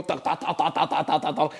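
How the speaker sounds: rapid-fire "tak" mimicking techno; mechanical, evenly repeated beat noises; imitated techno beat.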